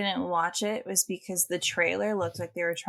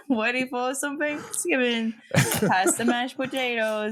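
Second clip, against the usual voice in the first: put-on Boston accent; 4 semitones higher than usual. Joining the Boston accent joke.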